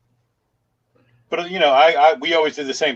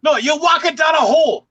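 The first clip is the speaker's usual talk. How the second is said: put-on Scottish accent; 11 semitones higher than usual. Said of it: "he does it in the Scottish accent".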